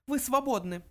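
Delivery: high, icy mouse voice; curt little mouse; cold dismissal. A narrator's reading. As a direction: cut him off coldly in a tiny high voice. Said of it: "холодно отрезал Бенджи".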